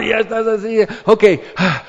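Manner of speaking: high, loud and breathless after the goal; excited fan. Pretend. Fan keeps cheering after the goal, excited and loud. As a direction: still riding the thrill of the goal.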